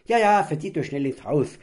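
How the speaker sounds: loud, high cheeky shout; a boy gleefully shooing his parents inside; cheeky call. Acted. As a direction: shoo them off with glee. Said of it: "rief Borki übermütig aus".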